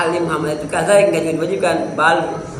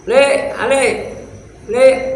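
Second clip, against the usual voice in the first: loud, rude call to someone behind, high and slow; 8 semitones higher, 4 dB louder, slower than usual. Retold or imitated. Teacher mimics a rude man shouting for someone behind, loud. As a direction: holler rudely over your shoulder. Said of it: "Itu namanya songong".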